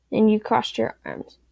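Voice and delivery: plain reading voice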